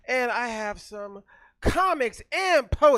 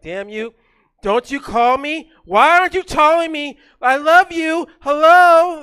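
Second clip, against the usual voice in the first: mock needy ex, getting higher and louder; nagging ex-girlfriend; 6 semitones higher, 13 dB louder than usual. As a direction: whine like a clingy ex demanding a call. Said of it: "I call it my ex-girlfriend that keeps ta-, taunting me".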